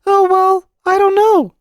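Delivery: put-on very high, loud child voice; uneasy little boy. A narrator's reading. Boy pressed by uncle for his secret, high and uneasy. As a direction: squirm like an uneasy small boy. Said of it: "said the boy uneasily".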